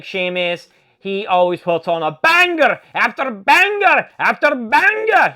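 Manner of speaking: a hint of an Irish accent on "banger after banger". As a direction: imitate Sheamus in a light Irish accent. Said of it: "I did have to do it in an accent".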